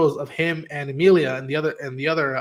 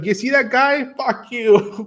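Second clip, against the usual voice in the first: higher, louder mock taunt; gloating taunt; 8 semitones higher, 5 dB louder, slower than usual. Hero gloating at a rival, loud mock taunt. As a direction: rub it in his face.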